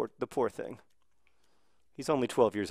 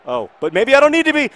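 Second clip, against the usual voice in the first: high, louder, hope building; rising excitement; 14 semitones higher, 15 dB louder than usual. Real, not acted. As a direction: catch your breath as the chance opens. Sudden chance on goal.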